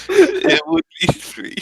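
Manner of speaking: high, slow laughing through words. Real, not acted. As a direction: giggle it out. Laughing at their low level.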